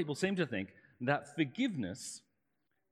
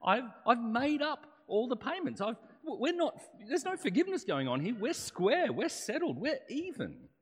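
higher, indignant pushback at the banker; offended borrower refusing the banker's forgiveness, higher and indignant; offended protest; 9 semitones higher, 3 dB louder than usual. Retold or imitated. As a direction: insist you've paid it all, affronted. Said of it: "I'd be kind of offended".

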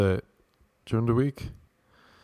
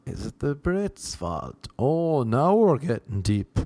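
ordinary talk, nothing marked

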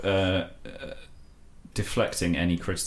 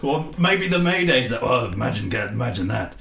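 higher-pitched put-on royal voice; Prince Philip; 3 semitones higher than usual. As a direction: play a royal addressing a young man. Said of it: "I'm Prince Philip".

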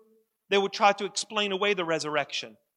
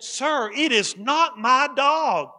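slow, louder, each word pressed out; slow firm insistence; 7 semitones higher, 6 dB louder, slower than usual. Acted. Dog owner refusing the replacement dog, slow and firm. Owner arguing with cargo handler.

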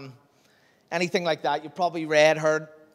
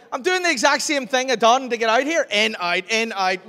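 flustered protest; 7 semitones higher, 6 dB louder than usual. Retold or imitated. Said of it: "I was like".

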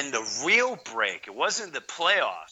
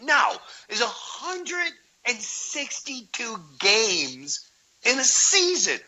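exasperated protest; 9 semitones higher, 5 dB louder, slower than usual. Retold or imitated. Arguing with wife about games.

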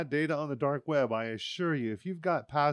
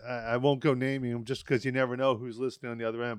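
ordinary talk

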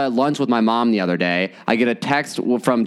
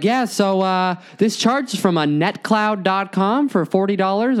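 higher, slowed, teasing rep voice; smug bank rep; 8 semitones higher, slower than usual. Retold or imitated. Bank rep slowly reads the porn charge aloud, high and smug. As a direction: read the charge out slowly, enjoying it. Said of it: "And he's like".

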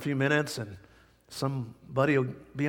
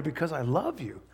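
soft, tender declaration; 7 dB quieter than usual. Acted. Refrain drops to soft.

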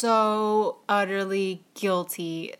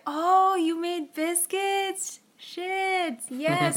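high-pitched, thrilled husband impression; excited imitation; 8 semitones higher, much slower than usual. Wife imitates husband finding fresh biscuits, excited. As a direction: imitate his delighted discovery, voice pitched way up. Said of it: "So he's all excited".

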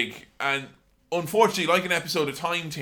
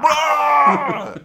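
high, loud bellow; mock Brian Blessed bellow; booming shout; 11 semitones higher, 8 dB louder than usual. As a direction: roar it like a hammy actor. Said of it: "So he can come and say".

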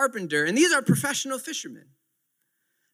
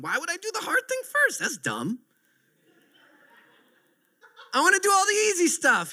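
put-on childish voice; 8 semitones higher than usual. Son's logic voiced.

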